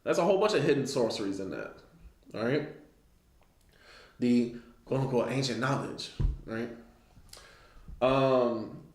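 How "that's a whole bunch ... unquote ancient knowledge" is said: normal talking voice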